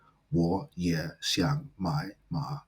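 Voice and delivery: 我也想买马 with every word low and dipping, separately; all third tones. Shown as a demonstration. Teacher shows the difficulty, 我也想买马 word by word in low third tones. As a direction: dip low on each word of 我也想买马, one by one. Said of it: "imagine how difficult that would be to pronounce".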